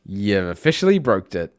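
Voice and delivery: usual speaking voice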